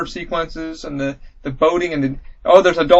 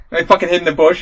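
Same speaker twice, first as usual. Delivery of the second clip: usual speaking voice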